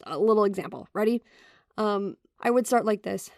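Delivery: ordinary talk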